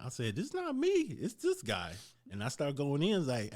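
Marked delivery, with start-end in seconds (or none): 0.4-1.9 much higher, slow indignant protest; mock protest. Him telling the owner it's the other guy, high slow protest. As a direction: stretch it out high like a wronged man.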